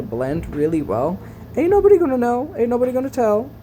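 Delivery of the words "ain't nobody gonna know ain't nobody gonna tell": high sassy sing-song aside; sassy drag queen. Pretend. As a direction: throw it out with sass, pitched up.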